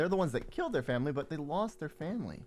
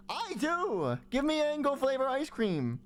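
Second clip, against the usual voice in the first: high demanding voice; 11 semitones higher, 6 dB louder, slower than usual. Pretend.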